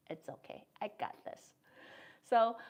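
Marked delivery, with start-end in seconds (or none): 0.1-1.5 unvoiced whisper, mock reassurance; whispered imitation. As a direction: whisper it like brushing off help.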